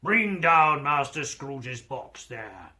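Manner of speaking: slow, loud bellow; terrible schoolmaster's voice. A narrator's reading. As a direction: order it down the hall, fearsomely. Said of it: "A terrible voice in the hall cried".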